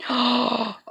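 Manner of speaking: high gasp of delight. Real, not acted. Vlogger finding another gift, high delighted gasp. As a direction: gasp at a cute surprise.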